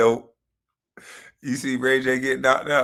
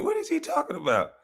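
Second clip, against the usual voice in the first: mock disbelief; 3 dB quieter, faster than usual. Real, not acted. Mocking disbelief.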